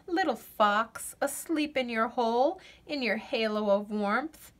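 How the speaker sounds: plain reading voice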